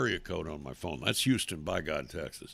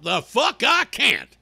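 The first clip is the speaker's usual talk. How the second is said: loud, high, drawn-out retort; defiant outburst; 9 semitones higher, 8 dB louder, slower than usual. Real, not acted. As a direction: fire back indignantly, stretching the words. Angry retort to the quoted objector.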